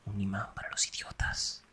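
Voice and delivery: hushed, slow aside; quiet dig; soft teasing aside. Acted. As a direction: murmur it just to her.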